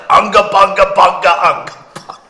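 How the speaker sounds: a lightly voiced mocking puppet babble; mock puppet gibberish. Mocking a puppet, said as light gibberish. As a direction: toss off a silly puppet's gibberish. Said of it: "puppet character of a Filipino".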